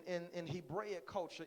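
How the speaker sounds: normal talking voice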